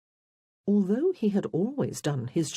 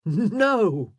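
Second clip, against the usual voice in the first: loud, raised exclamation; hearty amusement; 5 semitones higher, 7 dB louder than usual. A narrator's reading. Elaine hears of Wolf's rescue, heartily amused. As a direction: burst out in delighted disbelief. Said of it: "Elaine laughed heartily".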